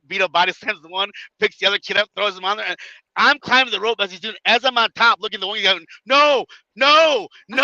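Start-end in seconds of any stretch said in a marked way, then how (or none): 6.0-7.6 high, loud pleading; imitating a scared opponent; mock panic. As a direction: beg like you're about to be crushed. Opponent panicking before the splash.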